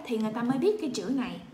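ordinary delivery

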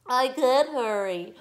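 loud, high, dragged-out whine; hammed-up tearful crybaby voice; sobbing. A narrator's reading. Girl told to hurry by mother, whiny sobbing. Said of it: "Melody sobbed".

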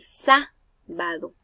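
slow "Sábado", leaning hard on SÁ; stress on first syllable. Shown as a demonstration. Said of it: "en la A".

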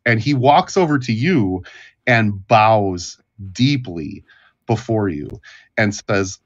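usual speaking voice

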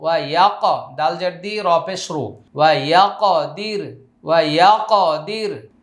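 ordinary talk

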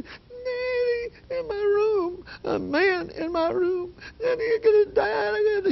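very high imitated terrified wail; shaking, scared squeak. Daughter telling her father of a man in her room, terrified squeal. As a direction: struggle to get it out through the shaking. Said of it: "she couldn't for shaking and shaking".

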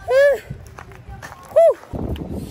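high, puffing whew of exhaustion; breathless whew. Real, not acted. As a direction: blow out a high tired whew. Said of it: "I'm out of breath".

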